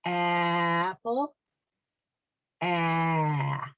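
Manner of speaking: Apple, then only the open ah held alone; stop on the vowel. Shown as a demonstration. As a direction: cut Apple off at its first ah. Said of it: "start to say apple again, but then stop".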